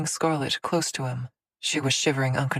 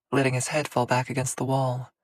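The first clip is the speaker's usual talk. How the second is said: ordinary delivery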